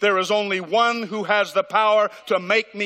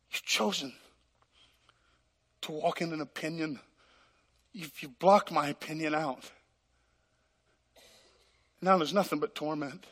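soft, lower, grieving voice of God; tearful God; 3 semitones lower, 9 dB quieter than usual. Acted. God grieving to the unforgiving man, soft and tearful. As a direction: grieve quietly over the lost. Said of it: "with tears running down the face".

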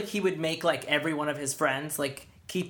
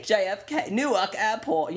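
put-on posh accent; 6 semitones higher than usual. Mock posh airport names.

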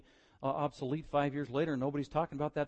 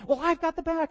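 put-on much higher childish claim; another kid; 8 dB louder than usual. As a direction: squeak it like a bossy child. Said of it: "one of them jumps in".

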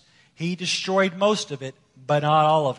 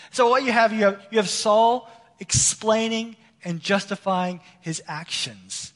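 usual speaking voice

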